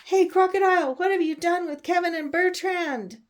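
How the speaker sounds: high, loud shouted call. Acted. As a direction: yell it out to the crocodile, demanding answers. Reader calls out to the crocodile.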